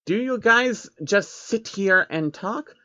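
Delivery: put-on high, slow childlike creature voice; little alien asking about the building; curious changeling. Changeling asks the men.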